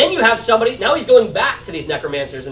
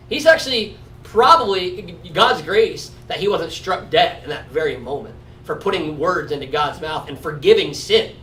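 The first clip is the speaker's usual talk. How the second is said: ordinary delivery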